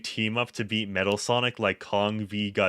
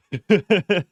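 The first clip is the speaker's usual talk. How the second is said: quick high chuckle; short laugh; 9 semitones higher, 7 dB louder than usual. Real, not acted. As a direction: break into a laugh. Laughs mid-sentence.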